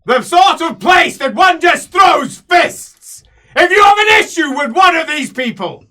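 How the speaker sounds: slow, roaring imperial rebuke; furious shout. Acted. Emperor shouting down the brawling player, furious roar. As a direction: bellow it out, dragging each word.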